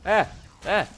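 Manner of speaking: short high yelps of fright; startled yelps. Real, not acted. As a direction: yelp in fright twice. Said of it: "it scares me every time".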